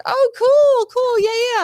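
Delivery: high, bright eager cool cool; flattered excitement. Retold or imitated. Hearing the grip remembers the shoot, delighted. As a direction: gush like you're flattered.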